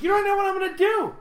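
high, fast playful voice; excited teasing. Retold or imitated. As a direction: rush it out, high and giddy. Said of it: "I'm like".

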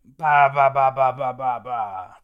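loud giant gibberish, fading at the end; booming giant. Retold or imitated. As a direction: bellow nonsense like a huge giant. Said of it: "And Brogy's like".

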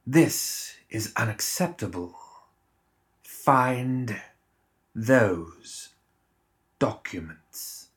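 slow, soft, cold fury held back; icy, restrained threat word by word; quiet menace. A narrator's reading. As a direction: let the rage seep through without raising your voice. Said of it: "didn't raise his voice, but let an edge of rage".

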